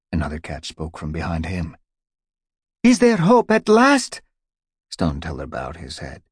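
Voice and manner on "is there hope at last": much higher, small eager voice; high hopeful cat. Acted. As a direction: cry out in hope, very high. Another cat calls from behind.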